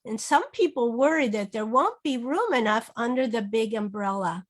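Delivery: ordinary delivery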